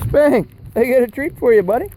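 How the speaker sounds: upbeat voice for a dog; talking to the dog. Real, not acted. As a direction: call the dog over with a treat.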